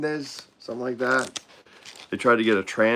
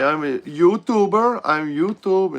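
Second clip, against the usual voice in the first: higher, louder indignant insisting; insistent YouTuber; 6 semitones higher, 5 dB louder than usual. Retold or imitated. YouTuber insists to airport staff, higher and louder. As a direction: plead your own importance, hammed a little.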